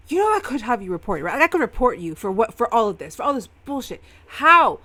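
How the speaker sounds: loud, high, incredulous tirade; angry yelling. Acted. Samuel berating Eamon for losing Arden, furious yelling. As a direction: yell it in outrage, voice climbing. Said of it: "pointing and yelling".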